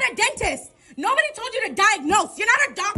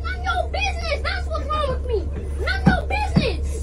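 high, heated comeback; angry retort; 7 semitones higher than usual. Acted. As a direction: throw it back at her, high-pitched. Heated quarrel on the bus.

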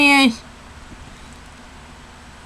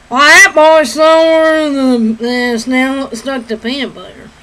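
Foghorn Leghorn. Acted. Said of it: "Foghorn Leghorn".

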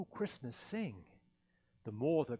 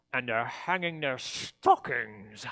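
a louder sneering snarl; 3 semitones lower, 7 dB louder than usual. A narrator's reading. The Grinch sneering at the Whos' stockings, snarling. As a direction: sneer the words out. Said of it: "he snarled with a sneer".

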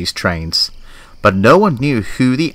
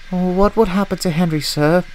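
high, worried little voice; shaky and scared; 9 semitones higher than usual. Acted. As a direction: ask it nervously, voice raised and unsure. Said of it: "asked Thomas shakily".

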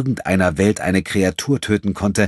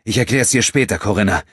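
louder, urgent and pressed; urgent, sharp; 6 semitones higher, 4 dB louder than usual. A narrator's reading. André urging Corinna to wait, raised and urgent. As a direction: hurry to silence her, raised and firm.

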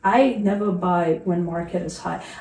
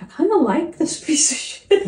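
higher, laughing confession; laughing admission; 9 semitones higher than usual. Retold or imitated. Telling husband she likes the bad building, laughing. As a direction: giggle it out fondly.

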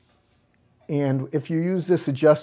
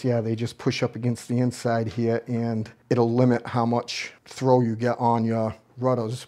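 ordinary delivery

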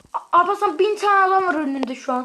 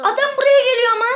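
panicked; 6 semitones higher, 4 dB louder, faster than usual. Real, not acted. Panicked alert about approaching enemy.

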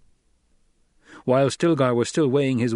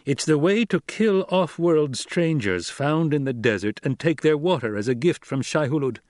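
firm recital; 6 semitones higher, 3 dB louder than usual. A narrator's reading. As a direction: spell out the law deliberately. Paul completes Stilgar's muttered words.

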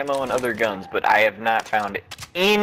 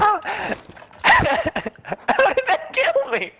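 raging gamer. Real, not acted. Dies in game, raging.

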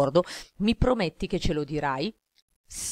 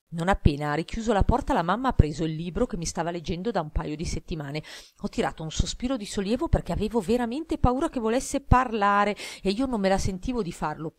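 plain reading voice

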